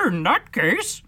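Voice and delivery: a pompous bird taking the floor; dodo voice. Acted. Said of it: "solemnly rising".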